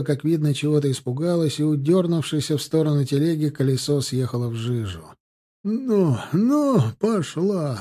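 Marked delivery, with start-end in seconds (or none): 5.6-7.8 high, drawn-out urging call; urging a horse. A narrator's reading. Owner whipping his stuck mare, urging call. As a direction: drive the horse on with a stretched call. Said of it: "настёгивал бедное животное хозяин".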